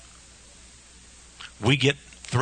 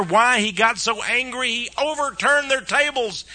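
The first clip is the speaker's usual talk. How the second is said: ordinary talk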